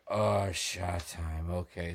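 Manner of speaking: slow, low hoarse drawl; sick low croak. Retold or imitated. Sick storyteller agreeing to his roommate's shot, slow and croaky. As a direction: drag the words out with a wrecked throat. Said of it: "And I'm like".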